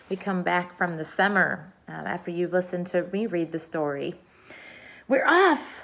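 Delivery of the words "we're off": high, raised call of departure; shouting. A narrator's reading. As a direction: call it out high across the room. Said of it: "someone was shouting".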